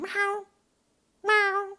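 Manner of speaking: very high squeaky meowing; mock meow. Cats begging for chicken, very high meow.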